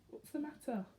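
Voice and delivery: ordinary delivery, nothing marked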